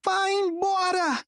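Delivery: high, drawn-out anguished scream; slow, strained high-pitched yell. A narrator's reading. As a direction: stretch the order into a high cry. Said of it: "gritou mais alto Nico".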